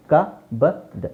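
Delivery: ಕಬರ್ಡ್ sounded out ka, ba, d; correct pronunciation. Shown as a demonstration. Teacher drilling how to say it, correct pronunciation. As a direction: split ಕಬರ್ಡ್ into short spaced sounds. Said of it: "ಏನಂತ ಹೇಳಬೇಕು".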